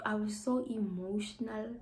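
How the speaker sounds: ordinary delivery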